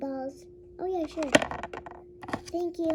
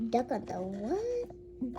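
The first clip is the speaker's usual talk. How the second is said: slow, softer puzzled question; drawn-out disbelief; 3 semitones lower, 6 dB quieter, much slower than usual. Pretend. Hearing of a loose duck, slow puzzled question. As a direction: stretch it out, lingering on the last word.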